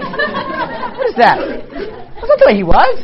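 high, slow, laughing through the words; amused incredulous laugh; laughing disbelief. Real, not acted. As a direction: laugh at how silly it is. Mocking movie portrayals of Jesus.

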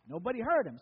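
usual speaking voice

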